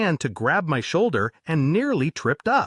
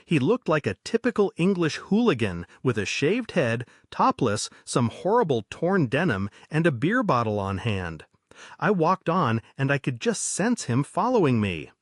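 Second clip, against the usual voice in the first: ordinary delivery, nothing marked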